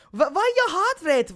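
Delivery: high-pitched attempt at a Russian accent. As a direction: lightly mimic a Russian-accented woman. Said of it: "Russian accent, she goes".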